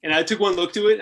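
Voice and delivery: ordinary talk, nothing marked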